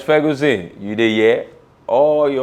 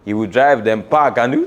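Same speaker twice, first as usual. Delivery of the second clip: ordinary delivery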